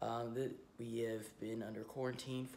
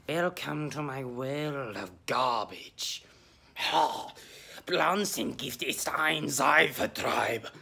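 mock grand host; 11 dB louder, slower than usual. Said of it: "don't know why I'm speaking German".

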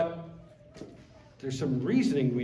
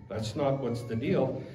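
ordinary talk, nothing marked

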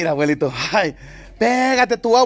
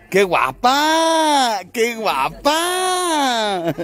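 very slow, tender admiration; drawn-out fond praise for the animal; cooing; 3 semitones higher, much slower than usual. Real, not acted. Cooing over a pretty animal.

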